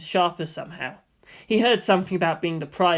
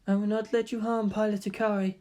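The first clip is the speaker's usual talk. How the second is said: flatly; 3 semitones higher, 5 dB quieter than usual. A narrator's reading. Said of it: "she said flatly".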